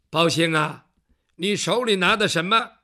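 low, loud magistrate calling his servant; a lightly voiced stern official; stern judge. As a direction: question him with a judge's weight. Bao Gong questions servant.

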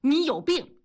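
high-pitched angry snap; flustered fury. A narrator's reading. Husband exposed by wife hits back, angrily. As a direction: lash out, stung and embarrassed. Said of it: "恼羞成怒地说".

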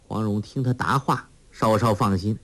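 ordinary delivery

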